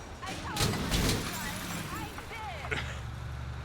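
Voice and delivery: laughing. Real, not acted. Laughing after a crash.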